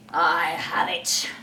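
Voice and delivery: slow, breathy scheming; sly whisper. Acted. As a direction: hush it like a secret plan. Hook whispers plan.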